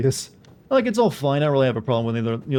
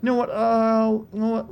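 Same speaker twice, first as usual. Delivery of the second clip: high, eager content creator; lightly mocking a lore YouTuber; 10 semitones higher than usual. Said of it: "they're like".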